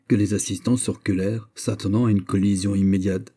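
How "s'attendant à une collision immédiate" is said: usual speaking voice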